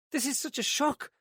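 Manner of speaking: a rattled woman groping for words; flustered. A narrator's reading. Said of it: "Joyce was flustered now".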